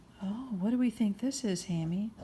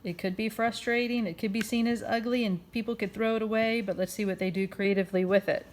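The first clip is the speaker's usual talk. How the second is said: ordinary delivery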